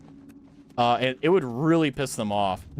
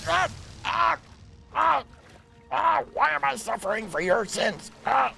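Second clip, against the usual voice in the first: mock suffering; 8 semitones higher than usual.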